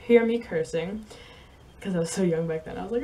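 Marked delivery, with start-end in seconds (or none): none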